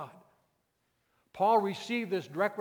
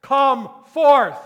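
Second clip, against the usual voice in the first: high, very loud command with a pause before "come forth"; loud commanding shout; 9 semitones higher, 17 dB louder, slower than usual. Acted. Preacher as Christ calling Lazarus from the grave, shouting. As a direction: shout it with full force to raise the dead. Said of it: "he shouted with a loud voice".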